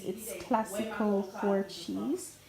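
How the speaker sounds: ordinary delivery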